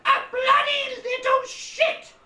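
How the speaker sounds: high, loud indignant yell; furious retort. Retold or imitated. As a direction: screech it at the boy like an outraged aunt. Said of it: "She'd suddenly retort and call me".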